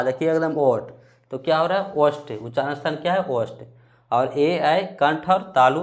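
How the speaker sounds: normal talking voice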